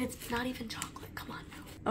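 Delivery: breathy whisper, half joking; whispered aside. Real, not acted. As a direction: drop to a confiding whisper.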